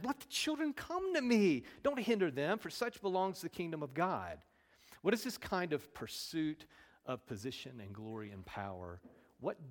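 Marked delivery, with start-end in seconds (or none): 0.0-4.4 high, loud indignant command, easing toward the end; indignant anger. Acted. Jesus to disciples turning children away, angry and indignant. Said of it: "He was angry".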